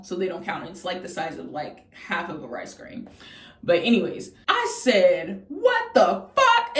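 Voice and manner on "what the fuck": very high, drawn-out disbelief; stunned outburst. Retold or imitated. Patient blurting at the scan result, stunned high outburst. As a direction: stretch it out high in retold shock. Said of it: "I said that word for word".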